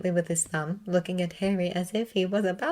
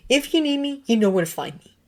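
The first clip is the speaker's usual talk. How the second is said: curt parting; 8 semitones higher, 4 dB louder than usual. A narrator's reading. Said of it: "Severus said".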